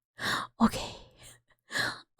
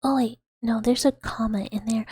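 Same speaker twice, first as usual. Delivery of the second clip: usual speaking voice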